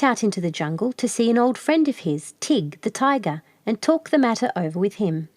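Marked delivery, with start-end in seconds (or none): none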